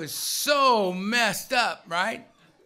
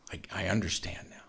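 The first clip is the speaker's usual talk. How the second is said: low, soft, near-whispered revelation; hushed realisation; 10 semitones lower, 9 dB quieter than usual. Retold or imitated. Sponsor to sponsee while driving along, hushed realisation. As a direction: let it dawn on you very quietly.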